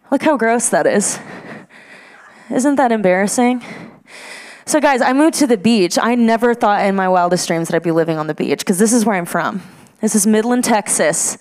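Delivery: ordinary delivery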